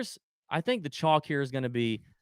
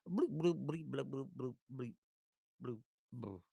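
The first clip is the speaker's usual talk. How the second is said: soft bloop bleep bounce noises, fading; quiet playful bloops; bouncing ball noises; 5 semitones lower, 9 dB quieter than usual. Retold or imitated. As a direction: mimic a ball hopping to a stop.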